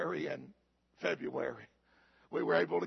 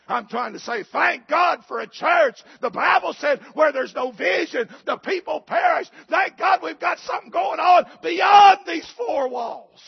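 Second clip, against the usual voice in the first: preaching at full force; 9 semitones higher, 13 dB louder than usual. Acted. Pastor thanking God for missions before the church, shouting. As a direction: lift the whole room with it.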